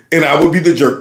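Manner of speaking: ordinary talk, nothing marked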